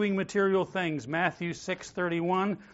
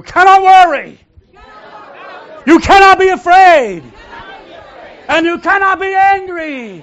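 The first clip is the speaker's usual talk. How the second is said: shouted high and loud like a fired-up preacher; 14 semitones higher, 19 dB louder than usual. Shown as a demonstration. Pastor asks the congregation to repeat it preacher-style, shouting loud. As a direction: preach it at full force, way up high. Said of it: "say it like a preacher".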